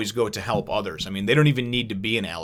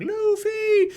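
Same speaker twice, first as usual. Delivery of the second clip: Vivi crying out. Retold or imitated. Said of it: "she's like".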